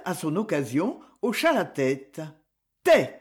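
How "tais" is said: loud, high cry of "Tais!"; a sudden loud outburst to hush them; sharp cry. A narrator's reading. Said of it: "cria-t-elle".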